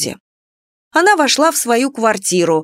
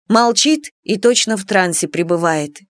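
ordinary delivery, nothing marked

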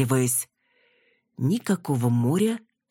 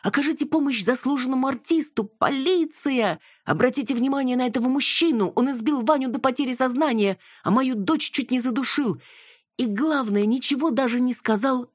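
very high, frantic mock yelling; imitated shouting; 11 semitones higher than usual. Daughter imitating mother crying out for police, shouting. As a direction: imitate mother's high-pitched hysterical outcry. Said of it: "как ты кричала".

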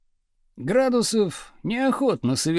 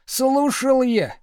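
higher, drawn-out sullen protest; defensive protest; 7 semitones higher, 3 dB louder, slower than usual. A narrator's reading. Pupil insisting to the teacher that he listened, defensive. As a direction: drag it out in a raised, defensive pitch.

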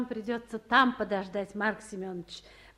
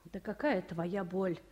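usual speaking voice

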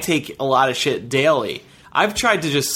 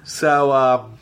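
talking while pinching nose; pinched nose, blocked voice; nose held shut. Real, not acted. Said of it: "We'll talk like this for the rest of the show".

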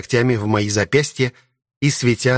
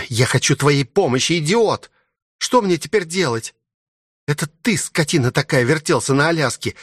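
higher voice of an angry princess, breaking off; exasperated princess; 7 semitones higher than usual. Acted. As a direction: rant accusingly, rising higher until words fail.

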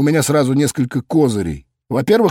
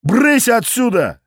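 barked; 10 semitones higher, slower than usual. A narrator's reading. Cop barks at fleeing drunks, angry shout. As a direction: bark them away like stray cats. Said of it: "рявкнул я".

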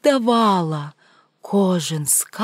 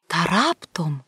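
ordinary delivery

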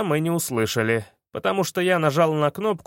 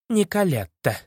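through gritted teeth; 3 semitones higher than usual. Acted. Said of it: "сквозь зубы процедила маменька".

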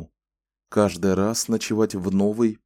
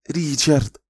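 louder, higher reproachful cry; exclaiming woman; 6 semitones higher, 4 dB louder than usual. A narrator's reading. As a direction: call him out in dismay. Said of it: "снова воскликнула женщина". (возмущённое восклицание)

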